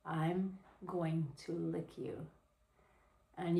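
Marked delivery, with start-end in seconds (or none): 0.0-2.3 soft and drawn out, word by word; slow seduction. Shown as a demonstration. Showing another sexy way to say it, slow and soft. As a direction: say it slowly and softly, savoring each word. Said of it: "You can say it like this".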